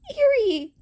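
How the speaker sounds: high, loud name; surprised call. Acted. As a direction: cry out her name on seeing her. Izuku sees Eri.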